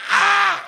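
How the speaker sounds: high, loud cramp yelp; mock pained cry. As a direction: yelp as if your leg seized. Charlie horse cramp.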